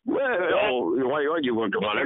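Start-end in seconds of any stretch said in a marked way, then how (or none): none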